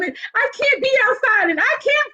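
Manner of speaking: very high, hammed-up inner panic; mock-frantic, realising what she did; panicked. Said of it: "my heart was like".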